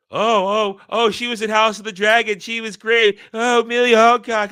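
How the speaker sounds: high, loud swooning fan voice; mock gushing fan. Mocking Millie Alcock fans with a high, swooning gush. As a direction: ham up a starstruck simp. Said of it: "Simpery exists even in our sphere".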